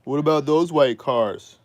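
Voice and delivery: high, loud teasing question; mocking grandma. Grandma needling about parked cars, high loud tease. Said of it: "And she's like".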